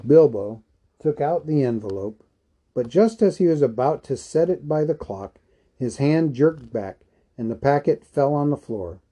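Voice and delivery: plain reading voice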